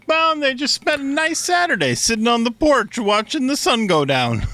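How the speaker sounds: high-pitched put-on narrator; hammed-up high, easygoing telling; mock folksy storyteller. Host inventing a nice Bible passage, mock high storyteller. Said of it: "nothing in the Bible that was like".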